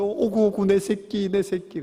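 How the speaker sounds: high, gushing mom imitation; overjoyed mother. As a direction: imitate a mother doting on her little one. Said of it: "엄마들이 너무 감격해서". (감격한 엄마가 어르듯)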